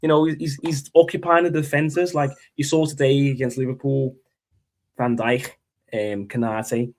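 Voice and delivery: put-on Liverpool accent with throaty consonants; hammed-up Scouse football fan. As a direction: moan about the team as a Scouse pundit. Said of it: "I can't do a Liverpool accent".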